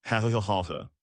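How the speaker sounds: wild manic cackle; unhinged laugh. A narrator's reading. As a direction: let loose a half-mad laugh. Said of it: "she threw her head back and laughed".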